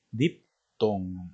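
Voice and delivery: "diptongo" broken after "dip"; split syllables. Shown as a demonstration. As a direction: pause midway through "diptongo": dip, tongo. Teacher spells out term by syllable.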